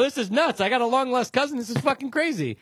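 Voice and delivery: high, loud, thrilled; excited. Retold or imitated. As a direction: yell it like great news.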